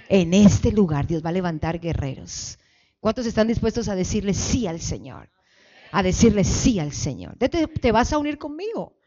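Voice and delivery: ordinary delivery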